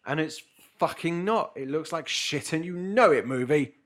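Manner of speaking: loud exasperated rant at the movie; vent, loud and fed up; angry rant. Real, not acted. Ranting at the movie.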